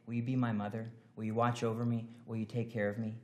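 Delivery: quiet, humble request; tender plea. Pretend. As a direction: pray it softly to a mother. Voicing the prayer to Mary.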